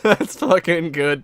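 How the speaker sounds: high, loud words through laughter; real laughter. Real, not acted. Streamer still amused by the video, laughing. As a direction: keep laughing as you speak.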